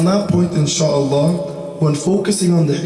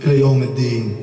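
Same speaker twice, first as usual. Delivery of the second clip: fast, chanted opening prayer formula; rapid Arabic invocation; 4 semitones lower, much faster than usual. Acted.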